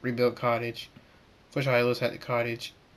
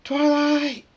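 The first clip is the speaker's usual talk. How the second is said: very high, loud cries for help; terrified scream; 8 dB louder, much slower than usual. A narrator's reading. Spike screams to Twilight for help, terrified high scream. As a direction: scream desperately for rescue. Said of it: "Spike screams".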